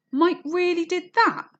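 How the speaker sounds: high, loud, slow disbelieving gasp; a shocked question read to show a question mark. Shown as a demonstration. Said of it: "he gasped".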